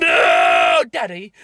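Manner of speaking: badly faked anguished cry; hammed-up daughter. Host mocks heroine crying out to her father, hammed-up wail. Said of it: "She's like".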